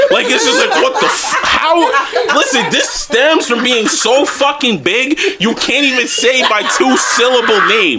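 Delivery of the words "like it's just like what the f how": laughing disbelief. Real, not acted. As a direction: voice jumps high in a laugh.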